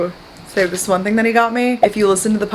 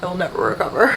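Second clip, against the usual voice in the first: ordinary talk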